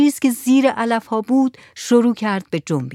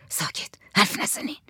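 whispered order to be silent; hushed whisper; 8 dB quieter than usual. A narrator's reading. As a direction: shush the room in a whisper. Hushing the room. (زمزمهٔ آهسته برای ساکت کردن)

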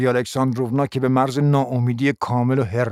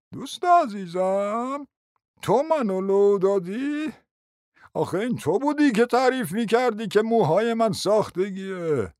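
hurt reproach; 8 semitones higher, 3 dB quieter, slower than usual. Acted. Betrayed prince reproaches friend.

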